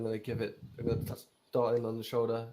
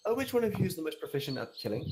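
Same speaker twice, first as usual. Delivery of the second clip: in character; 5 semitones higher, faster than usual. Acted. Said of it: "And he's like".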